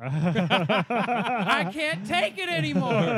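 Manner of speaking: high, loud, drawn-out mock outburst; losing it. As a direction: wail it out at the end of your rope. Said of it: "So I lose it".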